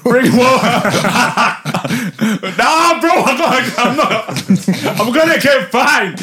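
high-pitched, laughing through the words; can barely get it out for laughing. Real, not acted. Cracking up at the question.

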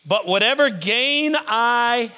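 slow, weighty scripture read; slow measured reading. Acted. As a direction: lay each word down slowly. Scripture reread slowly.

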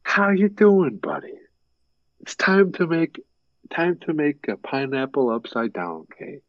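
high voice easing down as it goes; Fritz. Retold or imitated. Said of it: "And he'll be like".

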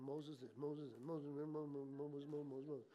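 low, soft, repetitive mumble; crowd murmuring. Acted. Preacher imitates the people blaming Moses, low murmuring. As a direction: run the name into a muttered drone. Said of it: "They're all murmuring".

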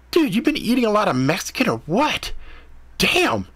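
grossed-out cat. A narrator's reading. Cat teasing Ethan about the smell, high and disgusted. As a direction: gag at the stench, mocking.

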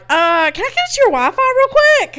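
mock sweet asking. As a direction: chirp it like an innocent little favour. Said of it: "And I'm like".

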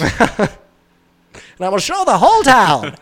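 high, loud mock-villain voice; Frieza. Carrying on the joke villain impression, high and loud. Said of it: "he was just like".

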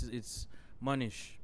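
usual speaking voice

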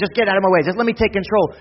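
loud, high, frantic demand; a hint of panicked woman snapping; mock panicked Mary. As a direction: fling it out like someone overwhelmed and grabbing control.

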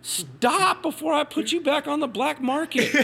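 high-pitched mock outrage, laughing. Husband scolding his wife for giving his stuff away, high mock outrage. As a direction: squeal it in fake horror at the wife.

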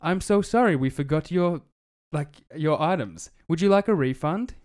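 high, loud chirpy robot apology. Retold or imitated. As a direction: play the cheerful phone bot saying sorry. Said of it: "it's a f-, full robot".

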